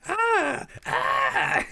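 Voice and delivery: very high squeal of delight. Real, not acted. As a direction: squeal at how cute it is. Squealing at the cute baby scene.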